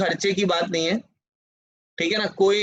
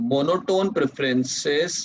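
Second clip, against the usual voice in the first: very slow, each phrase repeated; teacher dictating notes word by word; slow dictation; much slower than usual. Shown as a demonstration. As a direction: dictate the definition for students to copy. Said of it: "dictating second definition".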